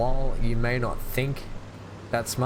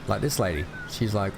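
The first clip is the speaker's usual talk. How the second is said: usual speaking voice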